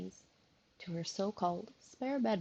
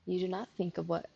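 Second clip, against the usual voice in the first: ordinary delivery, nothing marked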